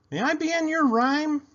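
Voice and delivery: put-on high girl voice asking; Muffet asking. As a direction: ask sweetly in a high voice. Muffet asks again.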